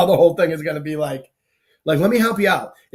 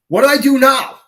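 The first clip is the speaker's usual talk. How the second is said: baffled question; 9 semitones higher, 6 dB louder than usual. Retold or imitated. Retells his own baffled question.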